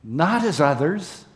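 ordinary talk

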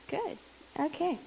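ordinary delivery

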